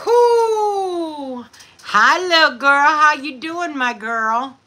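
loud, high cheer to her friend; excited greeting. Real, not acted.

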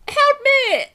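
loud, very high squealed plea; cry for help. Acted. Trapped creature calls out to the boy, high squealed plea. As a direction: shriek it like a tiny creature in trouble.

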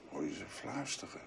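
a quiet, despairing voice about emptiness and hell; hushed dread. Acted. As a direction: murmur into the void. Dark hushed monologue about void and hell.

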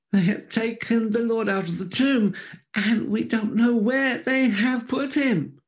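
high, loud, anguished woman's voice; distraught Mary. A narrator's reading. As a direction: cry it out as a frantic Mary. Mary reports the empty tomb.

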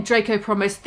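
ordinary delivery, nothing marked

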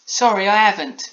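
put-on high, loud little-girl apology; Polly embarrassed. A narrator's reading. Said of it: "replied Polly in embarrassment".

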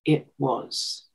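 low, quiet and tender; soft tender reply. Retold or imitated. Woman retelling George's reply to her, low and gentle. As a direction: let it land gently. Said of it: "he said two words to me".

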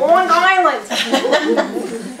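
drawn-out, laughing Long Island accent. Shown as a demonstration. Showing off her accent to a client, exaggerated Long Island. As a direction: ham up your own Long Island accent, stretched and loud. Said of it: "My accent?".

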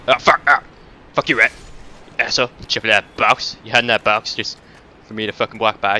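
loud real outburst of cursing; angry yelling. Real, not acted. Gamer jumped by a rat hiding in a box, furious yelling. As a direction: bark curses at the ambushing rat.

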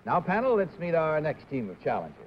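ordinary talk, nothing marked

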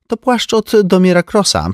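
lightly voiced knowing socialite; a hint of a snooty sister; Ursula's voice. A narrator's reading. (lekko wyniosła siostra)

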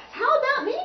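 high, loud eager cry; excited storybook exclamation, pitched right up. A narrator's reading. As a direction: pipe up to be included. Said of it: "cried the xylophone".

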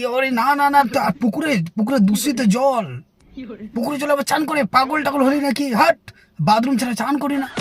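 high prissy disdain; mocking the fussy returnee's refusal; snobbish friend. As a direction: sniff at the dirty pond water. Snob friend refusing pond bath.

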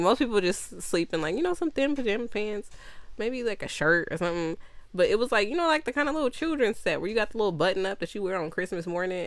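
ordinary delivery

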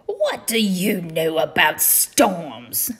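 slow pirate captain's challenge; scornful demand. Acted. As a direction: demand it slowly, as a captain putting down his son. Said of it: "Blackear demanded".

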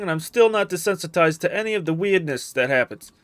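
normal talking voice

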